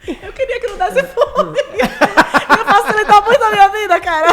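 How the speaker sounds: real laughter while talking; laughing. Real, not acted. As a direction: laugh out the words. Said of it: "laughing at shared joke".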